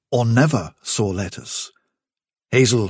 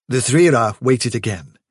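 ordinary delivery, nothing marked

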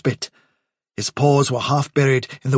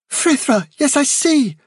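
much higher, eager realization; excited; 12 semitones higher, slower than usual. A narrator's reading. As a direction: seize on the idea at once. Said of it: "said an excited voice".

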